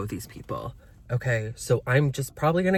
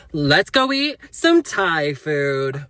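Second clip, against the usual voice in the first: drawn-out, slow, hammed-up influencer delivery; 3 semitones higher, 6 dB louder, much slower than usual. Shown as a demonstration. Vlogger redoing the dinner announcement for her viewers, influencer voice. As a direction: stretch it out louder like an influencer. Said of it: "let me say it like an influencer".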